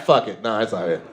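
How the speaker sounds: ordinary talk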